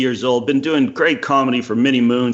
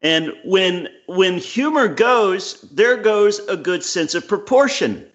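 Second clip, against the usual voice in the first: higher, slow, weighty quoting voice; slow, raised voice quoting Cleese to his friend; 6 semitones higher, slower than usual. Retold or imitated. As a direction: lay out Cleese's words slowly and gravely. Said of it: "and he, he said".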